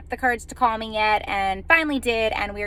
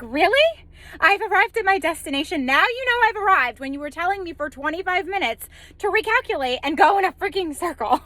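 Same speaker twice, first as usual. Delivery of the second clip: higher, indignant mock outrage at the GPS; sarcastic retort; 7 semitones higher than usual. To the GPS after twenty-five minutes of circling, sarcastic outrage. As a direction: scoff at the machine, pitched up and incredulous.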